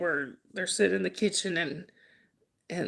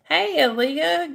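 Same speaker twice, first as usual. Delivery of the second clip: greeting; 8 semitones higher, 5 dB louder, slower than usual. Real, not acted. High slow greeting.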